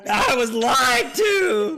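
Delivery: high, drawn-out playful wail; wailed protest. Real, not acted. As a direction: wail like you have been betrayed. Protesting the drink trick.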